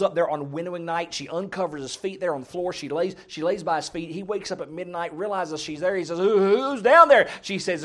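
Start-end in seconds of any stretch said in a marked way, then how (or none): 6.2-7.3 high, loud, drawn-out stammered question; mock fright on being woken; startled. Boaz wakes at midnight and finds someone there, startled.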